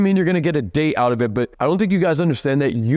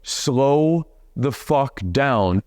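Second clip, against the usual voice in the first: each word heavily spaced; slow deliberate command; very slow, emphatic; much slower than usual. Shown as a demonstration. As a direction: drag it out to hammer it home. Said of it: "the catalyst for this fucking video".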